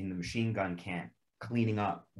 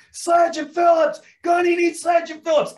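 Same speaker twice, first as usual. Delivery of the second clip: yelling down the line; 12 dB louder than usual. Retold or imitated. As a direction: bellow the names across the field. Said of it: "So I go like".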